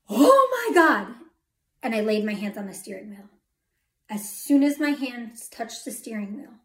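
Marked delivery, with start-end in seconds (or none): 0.1-1.1 high, loud, drawn-out cry of alarm; panicked yell. Retold or imitated. Drunk driver in a runaway car, panicked yell. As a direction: yell it out as the car moves by itself. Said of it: "I yelled".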